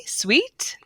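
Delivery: SWEET said high with a lift; high, rising SWEET; high pitch. Shown as a demonstration. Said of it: "E's ... are normally higher pitch".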